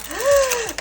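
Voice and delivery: sharp delighted gasp; excited gasp. Retold or imitated. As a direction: gasp in wonder. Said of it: "I think of Willy Wonka".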